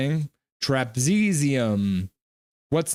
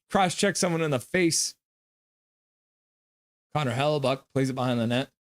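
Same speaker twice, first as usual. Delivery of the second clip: usual speaking voice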